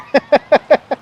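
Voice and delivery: high staccato laugh; real cackling laugh. Real, not acted. Commentator mocking Biden's view of himself, high laughter. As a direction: burst into high, rattling laughter.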